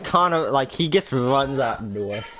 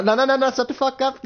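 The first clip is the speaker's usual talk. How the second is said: mock shout; 10 semitones higher than usual. Cutting Nathan off in story.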